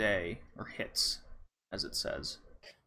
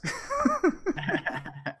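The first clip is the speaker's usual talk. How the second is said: squealing laugh, much higher; real high-pitched laugh; high burst of laughter; 3 dB quieter than usual. Real, not acted. Laughing at the bit.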